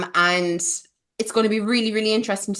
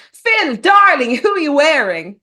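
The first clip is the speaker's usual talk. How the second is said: red carpet host; 6 semitones higher, 9 dB louder than usual. Pretend. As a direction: play a gushing red carpet presenter. Red carpet interviewer bit.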